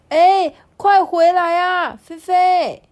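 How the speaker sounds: high, loud, drawn-out call; shouting after someone. Acted. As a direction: call them back from far behind. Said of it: "就在后面大声的喊着说". (在后面大声呼喊)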